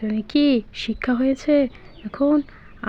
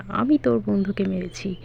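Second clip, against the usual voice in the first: ordinary talk, nothing marked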